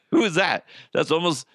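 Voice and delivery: ordinary delivery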